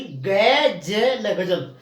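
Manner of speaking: ग, ज, ल drawn out slowly, then गजल joined up; pressed letter by letter. Shown as a demonstration. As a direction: spell out गजल sound by sound, pressing ग and ज. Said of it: "इसको दबाव दे करके".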